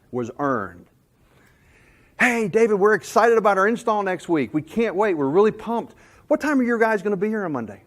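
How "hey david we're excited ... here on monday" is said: eager customer. Retold or imitated. Customer asks install time.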